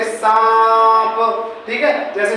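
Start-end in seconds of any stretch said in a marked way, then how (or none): 0.0-1.2 सांप with a long nasalised AA; सांप held on one steady nasal note; nasalised vowel. Shown as a demonstration. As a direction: stretch सांप through nose and mouth together. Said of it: "हवा मुंह से भी निकल रही है और नाक से भी".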